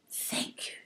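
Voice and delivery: soft unvoiced whisper. A narrator's reading. Fairy whispering thanks to the moon, hushed. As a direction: breathe the thanks almost silently. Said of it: "She whispered".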